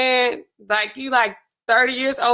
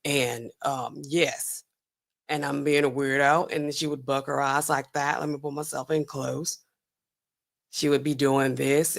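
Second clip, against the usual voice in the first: low, soft put-on baritone; Elizabeth Holmes deep voice; 7 semitones lower, 6 dB quieter than usual. Mocking Holmes practicing in the mirror, low fake baritone. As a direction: imitate her forced deep voice, pulled in close. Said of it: "She was talking like this".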